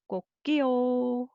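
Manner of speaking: rooster crow demo. Shown as a demonstration. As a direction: crow 꼭지요 to show the whole word. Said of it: "copying the sound of rooster".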